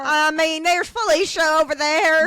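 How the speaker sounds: big Southern character voice; Southern accent. Pretend. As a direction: holler it in hammed-up Southern. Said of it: "doing Southern accents".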